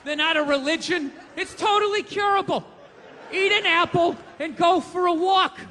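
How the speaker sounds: loud, high, sharp ranting. Real, not acted. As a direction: rant at them, loud and sharp. Ranting at fat people.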